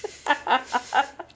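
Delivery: high, put-on spooky laugh; fake ghostly laugh. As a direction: cackle like a would-be scary ghost. Creature poses as scary ghost.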